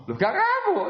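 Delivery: high, laughing mock protest; imitating someone. Teacher mimics a student insisting he isn't angry, high and laughing. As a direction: squeak out a playful denial, laughing. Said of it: "begitu dia kayak ngomongnya gitu loh".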